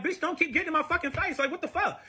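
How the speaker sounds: high-pitched, indignant retold warning; indignant outburst. Telling boyfriend to back off mid-fight, indignant.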